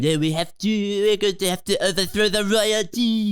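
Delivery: high, put-on French accent; hammed-up bad French accent; mock French accent. Film character plotting to overthrow the royalty, mock French accent. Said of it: "No one's speaking in a Russian accent".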